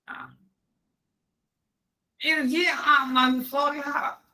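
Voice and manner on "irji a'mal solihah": high, slow pleading plea; lifted voice of a pleading ghost; begging dead soul. Retold or imitated. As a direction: beg high and drawn out for another chance.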